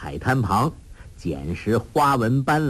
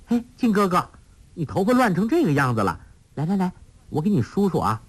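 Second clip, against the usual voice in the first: put-on high, fast, playful girl's voice; lightly voiced teasing girl; girlish Huang Rong; 8 semitones higher, faster than usual. A narrator's reading. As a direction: coax him sweetly like a lively young girl.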